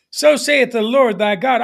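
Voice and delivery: normal talking voice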